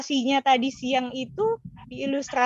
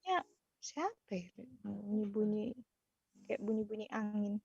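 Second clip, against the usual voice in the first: bad connection; 2 semitones lower, 16 dB quieter than usual. Real, not acted. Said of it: "Iya, nggak kedengaran".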